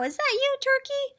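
high-pitched pig's question; surprised pig. A narrator's reading. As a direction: ask in a high, astonished voice. Pig spots Turkey in disguise.

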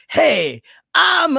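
bad impression. Mocking people who only say the name, high and loud. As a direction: ham up a lazy impression. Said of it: "when they do the impression is".

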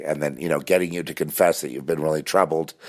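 usual speaking voice

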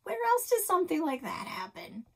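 wide-eyed wonder at winter; marveling. A narrator's reading.

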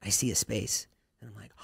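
ordinary talk, nothing marked

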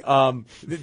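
usual speaking voice

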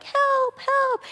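high mock cry of help, far above her normal voice; cytokines crying for help. Teacher voicing cytokines calling for help, high mock cries. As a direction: squeal it like tiny cells in distress. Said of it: "cytokines are like".

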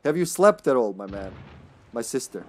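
usual speaking voice

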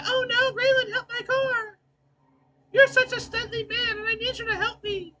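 very high, squeaky damsel voice; mocking impression. Player teasing their partner about pleading for car help, mock-helpless falsetto. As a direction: gush in a falsetto, hammed-up helplessness. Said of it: "That's how you be sound. That's how she really talks".

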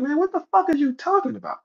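high-pitched indignant retort, retold; indignant disbelief. Speaker retelling his reply to a cocky stranger, indignant. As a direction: act out being totally put off. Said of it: "my face turned up".

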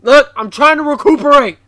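high, loud retort; exasperated outburst. Real, not acted. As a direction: snap loudly at the video. Snapping at the scary video.

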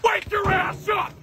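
high wake-up yell. Acted. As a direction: yell them out of bed. Yelling to wake the boys.

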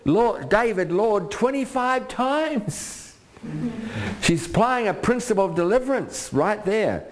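high, slow, fervent reading; preaching at full force. Acted. Preacher reading Abigail's plea, high fervent preaching. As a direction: lift the scripture up.

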